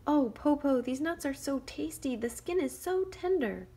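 loud, high taunting praise; teasing call. Acted. Shang teasing the wolf with tasty nuts. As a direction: tempt him from above. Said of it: "Shang said".

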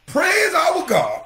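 high, drawn-out call to praise; proclamation. Retold or imitated. Proclaims the voice from the throne.

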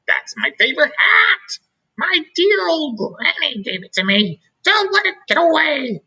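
very high frantic cry; teacher panicking as her granny's hat flies off, very high and frantic; panicked squeal. A narrator's reading. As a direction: shriek as the hat blows away.

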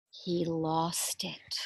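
very slow soft dramatic whisper; hushed whisper. Acted. Teacher reading the sad turn to children. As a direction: drop to a hush for the sad turn.

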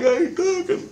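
very high voice through laughter; laughing squeak. Retold or imitated. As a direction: protest while laughing. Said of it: "And I'm like".

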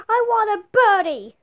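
put-on loud, high crying child; wailing kid. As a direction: bawl like a spoilt kid. Kid wails for birdie.

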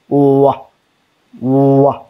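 isolated "wa", lips rounded then opening; teacher sounding out "wa"; sound demonstration. Shown as a demonstration. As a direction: glide from a w into "wa". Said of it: "the pronunciation of O".

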